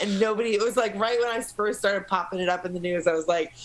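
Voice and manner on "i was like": usual speaking voice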